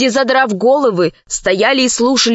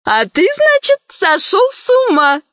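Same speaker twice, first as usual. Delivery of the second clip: high, melodic grandmotherly voice, much higher; melodic woman's voice; 9 semitones higher than usual. A narrator's reading. Grandma Lucrezia scolds Puccini from the sky, high and melodic. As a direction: sing out the scolding from above. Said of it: "послышался мелодичный женский голос".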